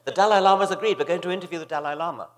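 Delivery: high, loud, breathless-paced mock excitement; great excitement. Producer phones with news of the interview, excitedly imitated. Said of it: "she said in great excitement".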